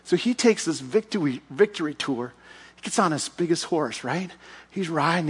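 usual speaking voice

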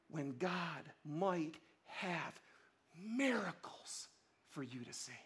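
"miracles" said soft and slow, almost breathed; hushed. Shown as a demonstration. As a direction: let "miracles" fall away quietly and slowly. Contrasts with the repeat after.

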